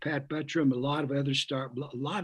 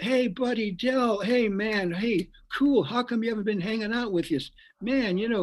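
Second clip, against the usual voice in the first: higher, bright-eyed pal calling out to a new friend; eager buddy greeting; 7 semitones higher than usual. Retold or imitated. Stuntman Red West hailing Del after lunch, eager and high. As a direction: play the suddenly friendly crew guy, voice up high.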